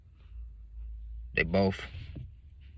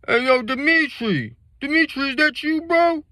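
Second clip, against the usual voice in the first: put-on drunk Jarvey; 7 dB louder than usual. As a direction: stretch it out like a drunk recognizing a friend. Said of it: "they drunk, slurring their words".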